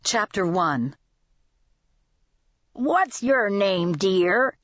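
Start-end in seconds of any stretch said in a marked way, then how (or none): 2.7-4.6 put-on old man voice. A narrator's reading. Old customer addresses waitress.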